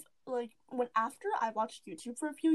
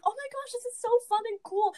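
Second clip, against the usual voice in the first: excited showing off; 11 semitones higher, 3 dB louder than usual. Retold or imitated. Bragging to little sister.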